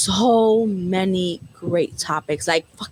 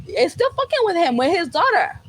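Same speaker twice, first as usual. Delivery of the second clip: high-pitched, incredulous outrage; outraged; 7 semitones higher than usual. Real, not acted. Viewer fuming at the guy in the movie, outraged. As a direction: pitch it up in indignant disbelief.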